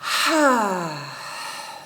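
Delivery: long audible exhale through the mouth, falling; big open-mouth sigh out. Shown as a demonstration. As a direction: let the whole breath go with a sigh. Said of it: "one more big, bold breath".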